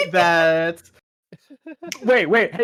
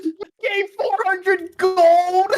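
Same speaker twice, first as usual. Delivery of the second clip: very high, drawn-out outraged reveal; incredulous high-pitched squeal of words; scandalised exclamation; 12 semitones higher, much slower than usual. Real, not acted. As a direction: stretch it out high in sheer disbelief.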